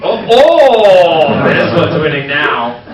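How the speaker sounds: put-on loud, slow playful drunk cry; mock drunk excitement. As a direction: ham up a tipsy outburst.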